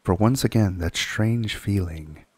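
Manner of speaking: plain reading voice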